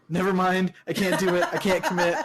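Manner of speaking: high, laughing backpedal; laughing protest. Retold or imitated. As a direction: cave in while laughing. Retelling own backing out, laughing.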